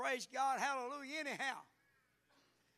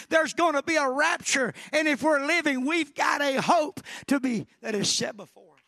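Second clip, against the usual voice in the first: forceful, insistent preaching; rising fervent preaching; 7 dB louder than usual. Real, not acted. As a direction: build the scripture into a full revival call.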